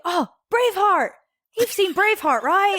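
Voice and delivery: high-pitched, eager mock exclamation; excited tourists. Excited tourists to a Scottish passenger, imitated with high-pitched glee. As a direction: imitate thrilled tourists firing off a question. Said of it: "they were just so excited".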